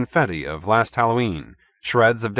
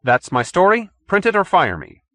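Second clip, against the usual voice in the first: higher, louder ultimatum; defiant; 10 semitones higher, 5 dB louder than usual. A narrator's reading. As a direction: dare the boss to fire him. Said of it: "stood on his dignity".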